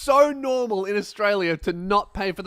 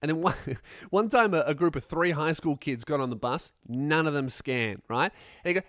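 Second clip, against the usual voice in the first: normal talking voice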